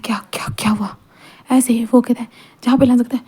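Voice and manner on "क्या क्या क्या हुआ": breathy, hushed question; barely voiced, close and soft; whisper. A narrator's reading. Wei Ying whispers to Lancer after the kiss.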